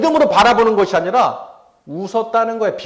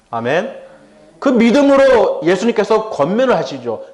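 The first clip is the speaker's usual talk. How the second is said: normal talking voice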